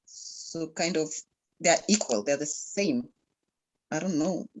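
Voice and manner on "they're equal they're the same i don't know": usual speaking voice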